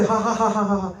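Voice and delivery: hearty mock laughter; acted laughter. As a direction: laugh along with the crowd. Animals laughing at the joke.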